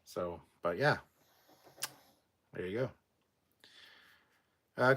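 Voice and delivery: ordinary delivery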